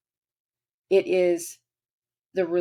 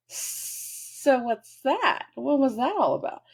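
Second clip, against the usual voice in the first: high, slow, prying voice; high, drawn-out voice of nosy Pluto; Pluto as nosy friend; 6 semitones higher, slower than usual. Acted. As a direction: probe like a knowing old friend.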